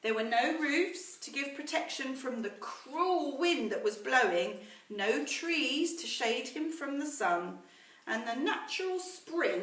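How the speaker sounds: put-on slow, measured storytelling to children; story narration. A narrator's reading. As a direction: read it like a bedtime story to the class. Teacher reads story to class.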